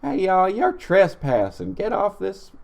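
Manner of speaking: a hammed-up [name] impression. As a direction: play it like Dolly working security. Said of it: "I'm [name]".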